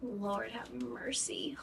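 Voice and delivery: ordinary talk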